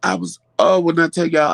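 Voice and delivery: normal talking voice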